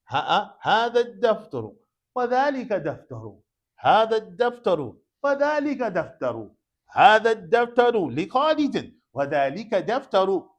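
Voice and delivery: emphatic classroom repetition, projected; calling out a drill. Shown as a demonstration. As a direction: drill the phrases out to the room. Drilling contrasted phrases aloud.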